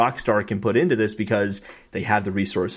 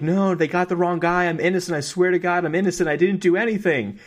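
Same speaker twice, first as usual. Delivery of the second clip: pleading prisoner; 7 semitones higher than usual. Retold or imitated. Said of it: "hear the prisoner being like".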